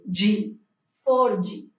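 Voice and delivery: four G with an added i after the G; Brazilian pronunciation. Shown as a demonstration. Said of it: "la D con la I tiene un sonido vibrante".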